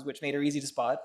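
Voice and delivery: ordinary talk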